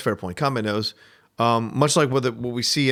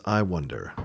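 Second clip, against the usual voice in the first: quiet musing quote; 6 semitones lower, 6 dB quieter, slower than usual. Retold or imitated. Quoting Kanbei's understated undercutting remark.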